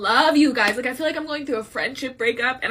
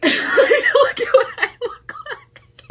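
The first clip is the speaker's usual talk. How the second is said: real teary laugh, much higher, louder and slow; breakdown laughter; 12 semitones higher, 8 dB louder, much slower than usual. Real, not acted. Creator mocks her tear-stained look, laughing in breakdown. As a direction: laugh helplessly at your own state. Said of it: "I'm having a mental breakdown".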